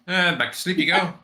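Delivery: ordinary talk, nothing marked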